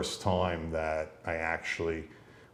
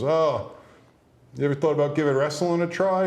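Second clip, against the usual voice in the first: lightly imitated gym stranger, higher and louder; recruiter's pitch; 9 semitones higher, 5 dB louder than usual. Stranger approaching a teen at the gym, recruiter's pitch. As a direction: pitch it high and eager, playing the recruiter.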